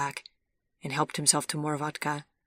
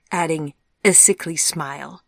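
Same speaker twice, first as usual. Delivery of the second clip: ordinary delivery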